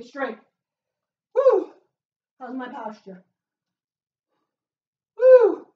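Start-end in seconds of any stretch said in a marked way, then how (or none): 5.2-5.7 high, loud cheer; excited whoop. Real, not acted. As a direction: whoop through the burn. Cheering mid squat set.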